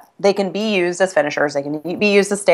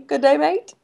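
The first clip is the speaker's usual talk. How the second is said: higher mock Australian "Good day, mate" as a question; 9 semitones higher than usual. Friend urging her to try the Australian accent. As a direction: prompt her with a playful Australian line. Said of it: "try your accent, though?".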